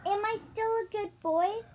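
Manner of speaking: high-pitched pleading dog voice, rising at the end; hopeful pug. Acted. Pug to Zeus after bounding back, high hopeful question. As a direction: ask high and hopeful, like an eager pet. Pug asks master for approval.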